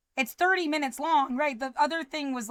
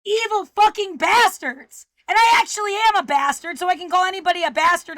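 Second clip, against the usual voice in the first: loud, raised-pitch angry tirade; furious cursing; 4 semitones higher, 6 dB louder than usual. Real, not acted. Speaker cursing the people upsetting them, furious and loud. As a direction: hurl it out with forceful anger.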